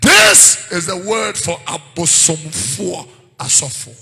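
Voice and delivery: loud, rhythmic, declaiming scripture; preaching at full force. Acted. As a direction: thunder the verses at the church.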